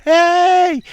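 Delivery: very high, loud answering holler; long, gleeful mock yell; imitated yelled greeting. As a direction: shout back as a stranger on the line. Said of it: "you'd yell up".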